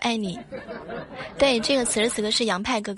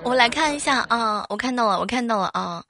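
ordinary talk

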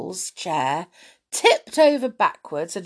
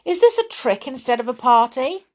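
indignant question; 6 semitones higher, 6 dB louder, faster than usual. Acted. Tom accuses Amelia.

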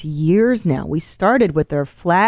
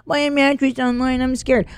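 a higher, mock-fearful shopper voice; imitating a nervous customer who is scared to buy a mattress online; worried customer; 6 semitones higher than usual. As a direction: play the timid online buyer, hammed up. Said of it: "there's some apprehension".

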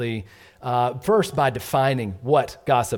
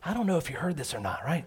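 softer, hushed gossip about Angie; quiet gossip; 7 dB quieter than usual. Retold or imitated. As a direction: confide it behind a hand. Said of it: "gets a little quiet".

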